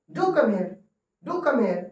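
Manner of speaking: "do" stressed high. Shown as a demonstration. Teacher showing emphatic request. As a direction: lean on "do" urgently. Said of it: "जोर से बोलना".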